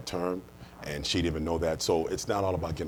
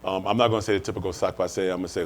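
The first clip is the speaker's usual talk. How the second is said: ordinary delivery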